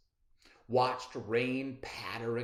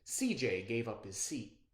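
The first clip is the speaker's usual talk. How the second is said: plain reading voice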